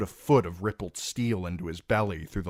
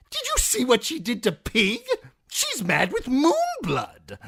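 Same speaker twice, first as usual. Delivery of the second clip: jester voice; 6 dB louder than usual. Acted. The fool refusing Timion, high and mocking. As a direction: toss it back like a cackling jester.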